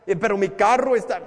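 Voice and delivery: worried objector. Acted. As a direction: plead the excuse like a stressed tenant.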